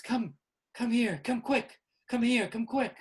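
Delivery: high, loud, urgent beckoning; hidden figure calling. Acted. A hidden figure calls the group to the exit, high and urgent. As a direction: call them over in urgent repeated bursts. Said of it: "A hidden figure says".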